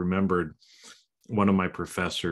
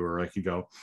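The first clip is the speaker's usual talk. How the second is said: normal talking voice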